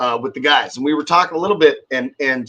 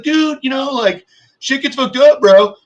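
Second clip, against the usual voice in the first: higher, louder careless bro voice; mock bro; 12 semitones higher, 7 dB louder than usual. Mocking the employee who shrugged off his mistake, careless.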